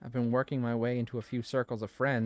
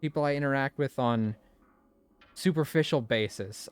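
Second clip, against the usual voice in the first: usual speaking voice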